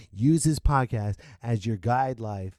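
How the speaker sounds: normal talking voice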